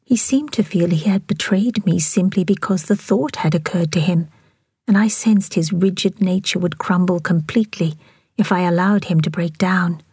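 usual speaking voice

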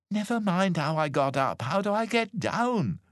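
high, urgent demand for help; impatient plea. A narrator's reading. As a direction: brush the question aside, pleading high. Stranded cousin wants to get down.

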